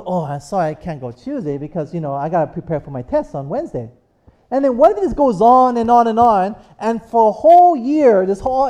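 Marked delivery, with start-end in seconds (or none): 0.0-3.9 low and much softer, making excuses; lightly voiced boyfriend dodging a date, low and soft; apologetic excuse. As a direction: duck out of it quietly. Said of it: "And then he goes".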